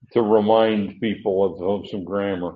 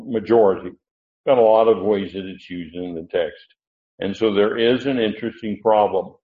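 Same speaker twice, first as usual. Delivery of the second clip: normal talking voice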